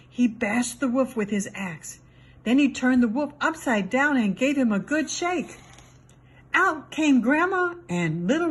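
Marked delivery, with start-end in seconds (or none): none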